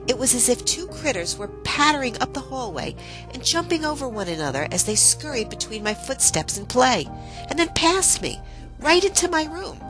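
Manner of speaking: high-pitched, wondering narration; excited storytelling. A narrator's reading. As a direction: build the thrill of the encounter, voice lifted. Excited telling of a fairy encounter.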